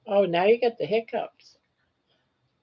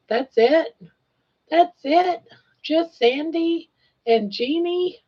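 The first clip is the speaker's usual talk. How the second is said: mock disappointment; 8 semitones higher, slower than usual. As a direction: pitch jumps way up in mock dismay. Mock dismay at few viewers.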